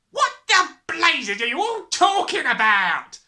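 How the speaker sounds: slow, high, furious yell. A narrator's reading. Father baffled by his family, yelling. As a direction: bellow it with drawn-out words. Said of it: "the father yelled".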